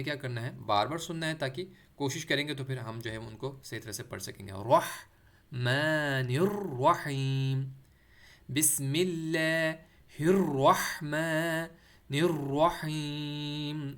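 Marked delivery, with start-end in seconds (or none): 4.5-13.9 very slow, drawn-out syllables, heavy rolled R in الرحمن and الرحیم; loud, measured teaching recitation, thin L in اللہ; careful recitation demo. Shown as a demonstration. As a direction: stretch each word, mid-throat H in الرحمن and الرحیم. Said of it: "را دونوں میں موٹا".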